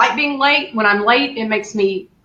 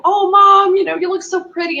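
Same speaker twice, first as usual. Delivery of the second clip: loving; 8 semitones higher than usual. Retold or imitated. Daughter making up with mom afterwards, retold loving tone. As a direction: lightly imitate doting on Mom, pitched up. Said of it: "I'm so loving to her".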